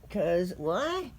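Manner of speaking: usual speaking voice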